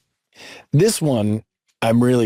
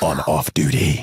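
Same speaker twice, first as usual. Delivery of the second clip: fighting game announcer; 9 semitones lower, slower than usual. Pretend. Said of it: "announcer voice".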